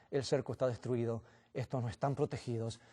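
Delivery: low, quiet mocking devil voice; hushed devilish taunt; sneering devil. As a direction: murmur low and soft, gloating. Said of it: "se ríe y dice".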